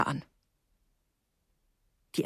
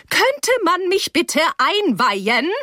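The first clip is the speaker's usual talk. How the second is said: high, slow, icy demand; icy indignation; 9 semitones higher, 3 dB louder, slower than usual. A narrator's reading. Teacher shut out of the talk, icily indignant.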